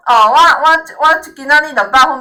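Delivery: ordinary talk, nothing marked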